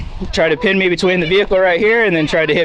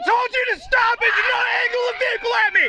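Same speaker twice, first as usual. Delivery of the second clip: high strained yelling; shouting commands; 5 dB quieter than usual. Real, not acted. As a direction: scream the orders. Guard yelling at driver.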